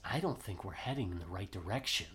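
low, hushed confidential aside; retold whisper. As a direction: pass it on quietly, only half whispered. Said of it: "he whispers to Washington".

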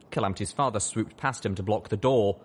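usual speaking voice

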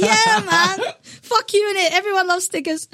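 hyped-up bragging. Retold or imitated. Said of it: "I was like".

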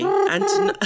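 high put-on posh newsreader; telephone voice. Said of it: "give us your telephone voice".

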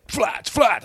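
high-pitched yelled name; imitated shriek. Overexcited man shouting into his mate's ear, shrieking. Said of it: "He shrieked in my ear".

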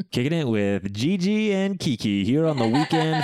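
hammed-up radio DJ announcing the station. Mock radio DJ intro.

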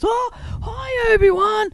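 put-on high, shouted child greeting; excited kid. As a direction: call out like a thrilled little boy.

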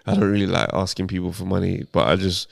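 low, soft, hesitant mock request; finessing girlfriend. Girl finessing a date for money, mock-reluctant and quiet. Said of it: "they'll make it seem like it's a privilege to you".

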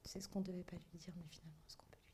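hushed conspiratorial whisper. Real, not acted. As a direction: whisper it like a secret. Secret whispered between them.

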